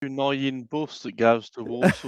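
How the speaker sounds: put-on Brummie accent. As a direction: do a comic Brummie local talking buses. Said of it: "I can do a Brummy accent".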